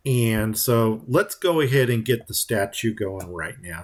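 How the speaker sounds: normal talking voice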